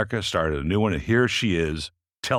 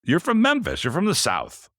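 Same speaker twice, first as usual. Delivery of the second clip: Memphis jumps up high; surprised; 10 semitones higher than usual. Real, not acted. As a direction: pitch leaps on Memphis. Host surprised by guest's origin.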